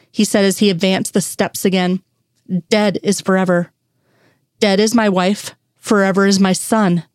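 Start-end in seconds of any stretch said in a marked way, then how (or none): none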